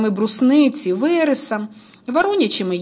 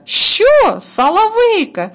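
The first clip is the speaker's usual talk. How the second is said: high, loud disbelieving cry; can't believe his ears; astonished; 11 semitones higher, 6 dB louder, slower than usual. A narrator's reading. Brother shocked at the boy's mistake, high and loud.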